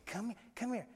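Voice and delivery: very soft beckoning; hushed coaxing. Retold or imitated. Sheila beckoning the child during preaching, hushed coaxing. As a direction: beckon a child under your breath. Coaxing a toddler quietly in church.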